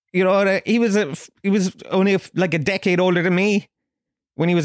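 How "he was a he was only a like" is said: ordinary talk, nothing marked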